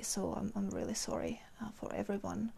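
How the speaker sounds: barely voiced, whispering through a lost voice; hoarse whisper. Real, not acted. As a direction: voice fading to a breathy whisper. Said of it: "I lost my voice".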